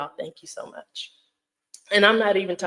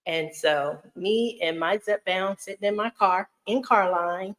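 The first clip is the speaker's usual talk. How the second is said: usual speaking voice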